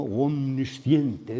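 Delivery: "Omnisciente" drawn out slowly, every syllable clear; spelled out slowly. Shown as a demonstration. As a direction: stretch "Omnisciente" syllable by syllable, like a lesson word. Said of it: "decimos así".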